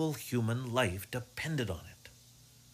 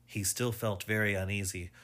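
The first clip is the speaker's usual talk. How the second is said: usual speaking voice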